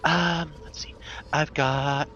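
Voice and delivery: high, lilting sales patter; potion seller. Acted. Seller pitching a clairvoyance potion, high lilting character voice. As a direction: show off the wares in a high voice.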